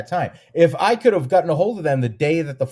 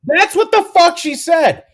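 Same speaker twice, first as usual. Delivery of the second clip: much higher and louder, indignant; exasperated outburst; 9 dB louder than usual. Real, not acted. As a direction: blow up in disbelief at the email. Fuming about the dealer's email.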